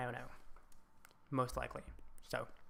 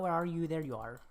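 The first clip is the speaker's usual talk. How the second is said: ordinary delivery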